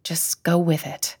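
soft under-breath aside; whisper. Acted. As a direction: hush it so grandpa can't hear. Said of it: "She whispered to James".

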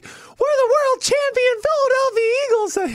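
very high, slow, strained put-on voice; David Akers impression. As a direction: squeeze it out high and slow like a proud kicker. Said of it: "he talked like this".

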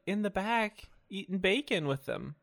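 high, aggrieved complaint; mock indignation. Acted complaint to coworker turned supervisor.

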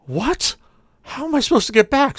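dismayed disbelief. Retold or imitated. Stranded passenger protests to sleepy cabbie, dismayed. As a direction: plead in disbelief.

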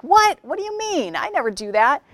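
sharp high indignant "What?"; defensive outburst. Retold or imitated. Employee caught off guard by a complaint, indignant. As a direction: snap back, defensive and shocked. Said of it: "your first instinct is to attack".

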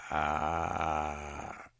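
long, low, steady drone; eerie noise imitation. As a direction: imitate a strange noise with a drawn-out "ah". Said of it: "made it sound like this".

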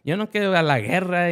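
lightly voiced worried mother; mom's voice.

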